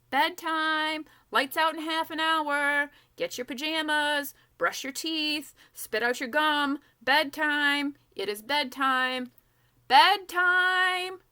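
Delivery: loud, high call to the whole warehouse. Acted. Girl calls the children to bed with loud high shouts. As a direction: bellow bedtime orders across the room. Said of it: "she calls".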